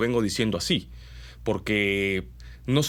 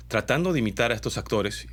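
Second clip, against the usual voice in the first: ordinary talk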